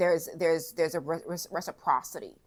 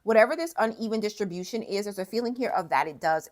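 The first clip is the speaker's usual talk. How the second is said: ordinary delivery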